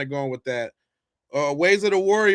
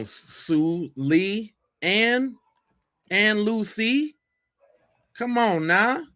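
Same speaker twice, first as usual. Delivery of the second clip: rising, higher, drawn-out name call; calling her out; 4 semitones higher, much slower than usual. Real, not acted. Host teasing the guest about hiding her name, rising call-out. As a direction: tease her by reeling off names.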